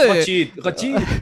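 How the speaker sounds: put-on slow drawn-out French accent. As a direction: stretch Ratchet like a French chef. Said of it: "el acento francés".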